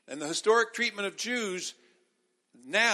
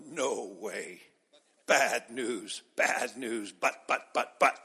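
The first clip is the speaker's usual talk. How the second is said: low, much lower mock grumble of a reluctant churchgoer; lightly mock the stubborn naysayer; grumbling goat congregant; 7 semitones lower, much slower than usual. Pastor mocking goat members refusing the sermon, low mock grumble. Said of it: "all the goats go".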